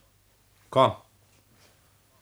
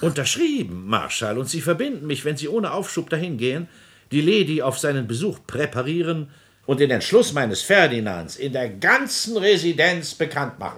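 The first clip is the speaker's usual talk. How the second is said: higher and louder, pushing forward; brisk urgent command; 7 semitones higher, 4 dB louder than usual. Acted. President urging the marshal to spread the news. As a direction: drive him out the door.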